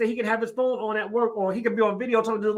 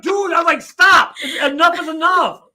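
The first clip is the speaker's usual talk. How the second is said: loud, raised-pitch telling-off; exasperated scolding; 4 semitones higher, 5 dB louder, slower than usual. Retold or imitated. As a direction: act out fed-up scolding, loudly. Acted scolding of the cussing kids.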